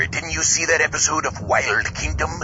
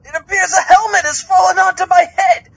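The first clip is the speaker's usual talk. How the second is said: high, loud, yelping squeals of panic; frantic panic; 14 semitones higher, 5 dB louder than usual. Acted. Zombie trapped in a diving helmet, squealing in high panic. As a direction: flail and squeal as the helmet jams.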